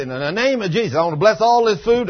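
a preacher calling out over lunch; loud blessing. Retold or imitated. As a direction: declare the blessing to the whole room. Proclaiming a blessing in cafeteria.

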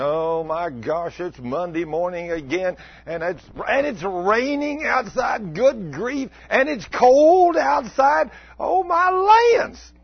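hammed-up whining, climbing to a loud, high wail; grumbling and complaining. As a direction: moan slowly, building to a mock cry. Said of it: "my grumbling and complaining".

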